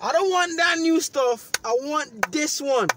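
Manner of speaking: very loud, very high string of no; furious yell. Retold or imitated. Refusing a new pair on the phone, yelling. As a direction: shout them down.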